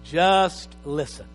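"Just listen" drawn out slowly; slow plea. Shown as a demonstration. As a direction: pastor pleads slowly with the congregation. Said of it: "repeated plea".

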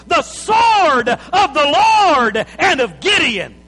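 slow, loud, high shouted war cry; battle cry. Acted. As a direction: roar it like Gideon leading the charge. Gideon's battle cry retold.

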